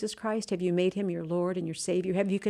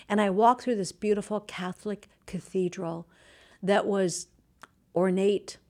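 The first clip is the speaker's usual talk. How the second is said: ordinary talk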